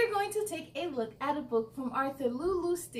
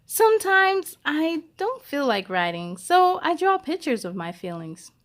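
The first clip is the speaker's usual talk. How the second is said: put-on louder, lively storybook reading for kids; reading to children; 2 semitones higher, 8 dB louder than usual. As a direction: read Esperanza's words out to children. Reads the book aloud.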